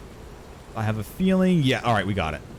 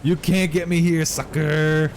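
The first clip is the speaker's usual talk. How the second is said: higher, teasing sing-out; playful taunt; 4 semitones higher, slower than usual. Pretend. Streamer safe from a game enemy, playful taunt. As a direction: taunt from a safe spot, pitched up.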